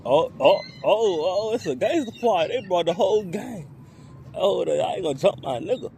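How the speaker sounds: playing a hyped-up bird spotting the gang; bird's excited voice. Narrator voicing a bird spotting the gang, mock excited. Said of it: "He said".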